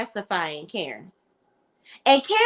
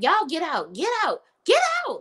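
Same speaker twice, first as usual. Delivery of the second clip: rising higher each "get out" until shrill-high; building mock yell; 7 semitones higher than usual. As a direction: escalate the mock tantrum. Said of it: "she's going crazy".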